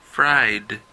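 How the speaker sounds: "fried" said with a final voiced d; teacher's model word. Shown as a demonstration. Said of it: "Se pronuncia como d".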